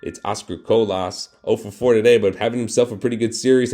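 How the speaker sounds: animated broadcaster call; play-by-play. Acted. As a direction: call it like live TV.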